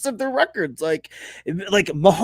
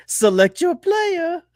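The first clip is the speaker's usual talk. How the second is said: high, slow video-game voice; game announcer; 7 semitones higher, slower than usual. Acted. Quarterback scanning the field, high slow game voice. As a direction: draw it out like a game menu prompt.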